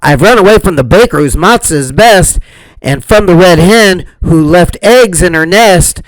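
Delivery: fast, lightly voiced brag; bragging. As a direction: rattle it off proudly.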